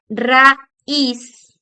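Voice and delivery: raíz split loudly into ra-ÍZ; stress on last syllable. Shown as a demonstration. Teacher showing two vowels apart, stress on ÍZ in raíz. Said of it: "su entonación va en la última sílaba".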